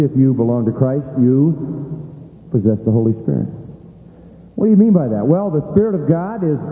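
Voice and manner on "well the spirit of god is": ordinary talk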